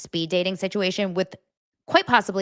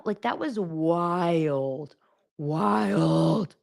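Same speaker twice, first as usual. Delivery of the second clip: slow stunned; much slower than usual. Real, not acted. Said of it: "stunned comment".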